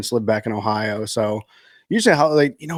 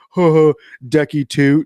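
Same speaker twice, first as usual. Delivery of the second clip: lightly voiced little boy, louder and higher; imitated laughing kid; 4 semitones higher, 6 dB louder than usual. Friend imitating a child after he farts, laughing kid voice. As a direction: chuckle out the words like a proud small boy. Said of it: "he laughs, and he goes".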